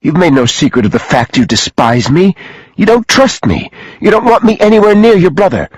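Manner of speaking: high-pitched, upset protest; distraught accusation. A narrator's reading. Sophie to the man who despises her, distraught. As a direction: pile up the accusations, climbing in pitch.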